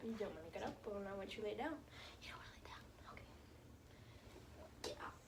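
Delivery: soft whisper beside a sleeping dog; hushed, breathy whisper. Real, not acted.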